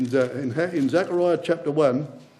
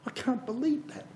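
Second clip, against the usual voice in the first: doubting human nature; 7 semitones higher, 8 dB quieter than usual. Retold or imitated. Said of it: "Your human nature says".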